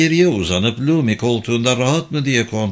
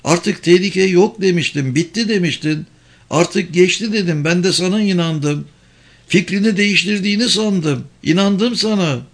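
higher-pitched tense reproach; hurt, suppressed anger; 7 semitones higher than usual. A narrator's reading. Woman reproaches driver for broken promise, angry and hurt. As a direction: press the accusation, jaw tight. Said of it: "dedi dişlerini sıkarak".

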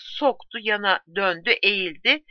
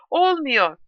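sobbing; 6 semitones higher, 4 dB louder than usual. A narrator's reading. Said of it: "diye hıçkırdı".

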